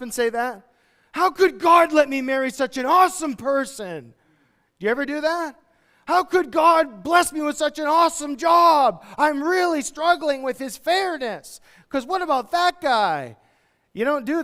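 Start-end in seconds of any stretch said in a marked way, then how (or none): 1.1-4.1 high, loud mock wonder; mock grateful voice. Preacher mocking a thankful believer, hammed-up wonder. 6.1-13.4 high, loud, slow mock whine; mock complaint. Preacher mocks congregation's inconsistency, slow sarcastic whine.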